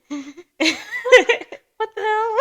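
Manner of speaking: high, loud, laughing what the hell; cracking up while replaying confusion; laughing disbelief. Recalling feeling lost in class, laughing disbelief. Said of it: "I'm like".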